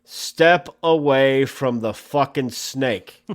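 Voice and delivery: hammed-up public safety announcement to snake photographers; mock news bulletin. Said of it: "fucking breaking news here".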